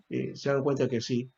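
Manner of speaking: ordinary talk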